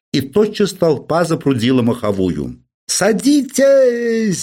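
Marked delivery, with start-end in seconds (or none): 2.9-4.4 high, slow, drawn-out loudspeaker wail; megaphone howl. Acted. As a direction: blare it down from the rooftop horn. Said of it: "завыл вдруг в рупоре ... неприятнейший тонкий голос".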